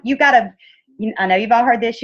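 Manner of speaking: usual speaking voice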